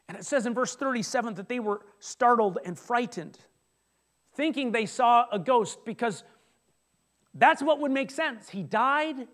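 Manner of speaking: usual speaking voice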